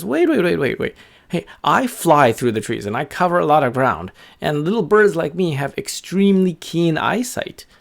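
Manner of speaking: lively bird, pitch leaping up; eager little bird. Acted. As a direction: jump in as an excited small bird. Voices the excited bird.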